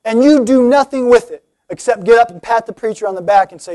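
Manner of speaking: usual speaking voice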